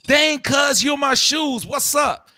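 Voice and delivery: high, aggressive call-out; combative energy. Acted. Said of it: "come with the energy like".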